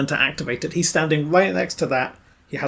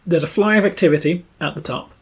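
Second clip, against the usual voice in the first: ordinary talk, nothing marked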